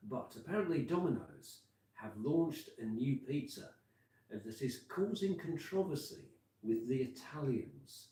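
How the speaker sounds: calm on-air radio link; easy, chatty DJ delivery; radio presenter. Real, not acted. Presents his live radio link.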